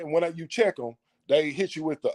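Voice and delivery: ordinary talk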